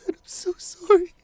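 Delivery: high, loud, sobbing sorry; crying apology. Acted. Partner sobbing an apology at the hospital bedside. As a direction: break down apologising through tears.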